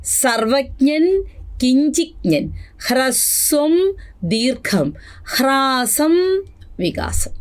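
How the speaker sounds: high, slow chanted word list; high sing-song drill. Shown as a demonstration. Teacher chanting word pairs high for revision. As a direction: chant each pair up high. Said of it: "ഒന്നുകൂടെ".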